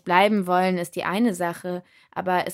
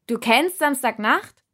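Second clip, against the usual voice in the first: higher, slow called question; 6 semitones higher, 4 dB louder, slower than usual. A narrator's reading. Narrator calling out to the spirit over music, raised. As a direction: call it out over the noise. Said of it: "rief ich".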